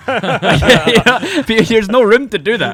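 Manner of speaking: high, loud talk through laughter; real laughter breaking into the words; laughing. Real, not acted. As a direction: laugh while making the point. Laughing at own anthem joke.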